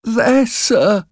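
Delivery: put-on tiny, very high child's voice; frightened boy. A narrator's reading.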